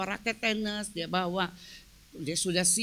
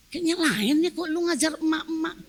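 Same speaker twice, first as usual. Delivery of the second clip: ordinary talk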